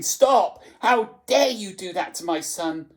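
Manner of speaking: high, loud indignant mother; outraged shout. A narrator's reading. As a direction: burst in shouting to protect a child.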